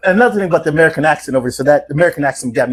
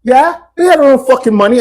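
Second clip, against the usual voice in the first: high mock English girl, suspicious; imitated English accent; 8 semitones higher, 4 dB louder than usual. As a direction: challenge him as a put-on English girl. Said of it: "the English girls over there".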